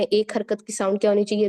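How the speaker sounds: usual speaking voice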